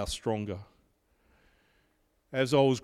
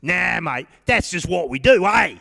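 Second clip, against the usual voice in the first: broad, higher, louder Aussie mate voice; Australian accent; 6 semitones higher, 9 dB louder than usual. Retold or imitated. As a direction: friend Dave brushing off praise in a broad Australian accent. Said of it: "He was very Australian".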